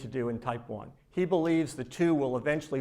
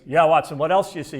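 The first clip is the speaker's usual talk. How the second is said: louder, mildly impatient retold question; a little frustrated; 8 dB louder, faster than usual. Holmes pressing Watson again, mildly frustrated. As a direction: let some impatience creep in. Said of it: "starting to get a little frustrated".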